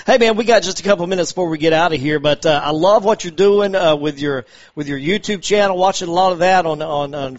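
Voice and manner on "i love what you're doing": ordinary talk, nothing marked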